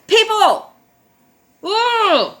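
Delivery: high loud yelp of disbelief; exasperated outcry. Real, not acted. Calling out to viewers in disbelief, loud and high. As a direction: throw it out in frustration.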